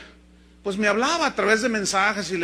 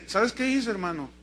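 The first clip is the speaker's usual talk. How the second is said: ordinary delivery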